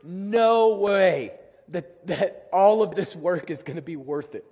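slow, drawn-out and defeated; exhausted despair. Retold or imitated. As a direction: drag it out like a spent rider at the top of the hill. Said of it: "and I was like".

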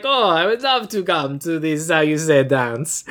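slow, halting fake Italian accent; lightly hammed Italian-style accent; mock Italian accent. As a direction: imitate a foreigner groping for the word, Italian accent. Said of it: "This guy's accent is Italian".

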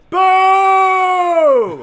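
long, high shouted boo; loud booing. Retold or imitated. Bartender boos when everybody cheered, loud shout. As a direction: heckle the crowd with a drawn-out yell. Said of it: "I would go".

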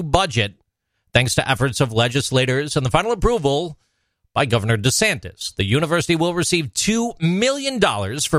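a lightly voiced news anchor read; mild announcer tone over a funding story. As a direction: read the item like a light newscast. Reads a news item.

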